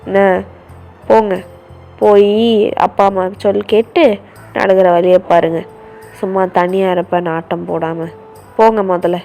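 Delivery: low, loud telling-off; angry brother on the phone. Acted. Brother scolding his sister's friends over the phone, low and angry. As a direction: warn the friends off, fast and firm.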